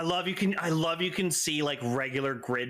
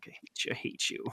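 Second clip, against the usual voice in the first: hushed, bitter whisper; 7 dB quieter, faster than usual. Retold or imitated. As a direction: breathe the hatred quietly. Mock outrage at new agent.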